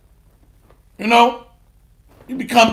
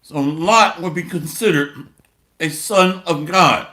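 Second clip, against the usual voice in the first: normal talking voice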